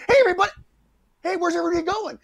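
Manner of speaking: very high, oblivious holler; bonehead cop calling out. Acted. Bonehead cops arriving late call out.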